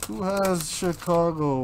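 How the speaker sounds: normal talking voice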